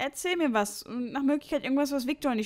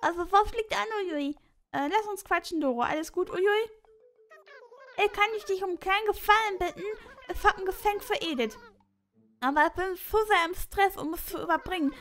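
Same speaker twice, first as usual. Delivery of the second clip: much higher, bouncy cartoon voice; squeaky villager voice; 9 semitones higher, 3 dB louder than usual. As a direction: play Doro as a frantic, squeaky little villager.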